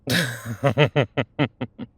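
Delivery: hearty chuckle; amused laugh. Acted. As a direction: burst out laughing in a quick run.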